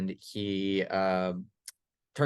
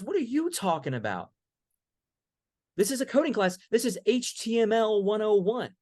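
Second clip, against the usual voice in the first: much higher and louder, indignant insistence; the student losing patience, near shouting; exasperated student; 10 semitones higher, 3 dB louder than usual. Retold or imitated. Student insisting to the professor it's coding class, shouting.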